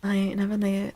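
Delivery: ordinary talk, nothing marked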